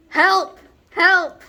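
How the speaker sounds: loud, high cries for help; screaming for help. Retold or imitated. Dragged toward teacher, teller yells help, loud and high. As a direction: yell help as if dogs are dragging you. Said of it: "So I'm screaming".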